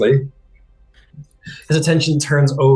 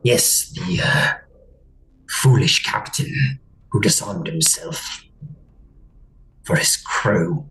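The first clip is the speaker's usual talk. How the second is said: low, unvoiced rasp of a suspicious mind-creature; whispery alien creature; 3 semitones lower, 4 dB quieter than usual. Captor confronting the Golden One, cold whisper. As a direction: hiss it out cold and accusing.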